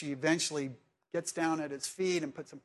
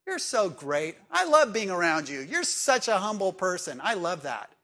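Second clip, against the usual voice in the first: effusive praise, swelling louder; gushing admiration; 5 dB louder than usual. Acted. Preacher acts out admirers flattering a humble servant, gushing. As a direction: lay the compliments on thick, mock-adoring.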